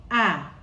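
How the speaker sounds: Ah said open like in father; teacher showing the Chichewa vowel; vowel sound ah. Shown as a demonstration. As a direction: model Ah as the a in father. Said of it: "Like ah in father".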